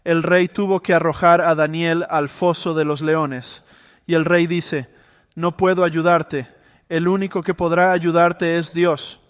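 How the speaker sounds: a pastor recounting Daniel in the lions' den, preaching with rising force; preaching at full force. Acted. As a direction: preach it out to the congregation, building the drama.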